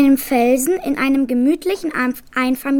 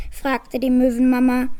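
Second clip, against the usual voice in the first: ordinary delivery, nothing marked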